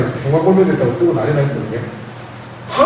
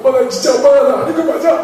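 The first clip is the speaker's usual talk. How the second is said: put-on much higher, wailing little boy; retold crying child; 9 semitones higher than usual. Crying boy asking his mother, high wail. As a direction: wail it like a sobbing kid. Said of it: "울기 시작해가지고".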